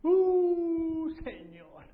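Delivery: dismayed groan. Acted. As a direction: act out Felipe's dismay. Felipe dismayed at the question. (quejido de desánimo, luego "Señor" en voz baja)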